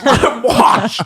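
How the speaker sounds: loud, high-pitched mock fury; Grimace, real mad. Grimace repeats his protest, high hammed-up angry yell. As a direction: yell it high, hamming up the outrage. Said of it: "Grimace gets real mad".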